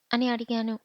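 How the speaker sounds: usual speaking voice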